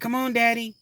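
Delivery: put-on high pleading child voice; imitating his little girl, high and pleading; little daughter voice. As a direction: play his three-year-old begging him. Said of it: "My daughter was like".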